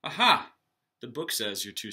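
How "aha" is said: loud, high aha of discovery; eureka. Acted. Knight finding the answer in his book, loud aha. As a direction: pounce on the idea.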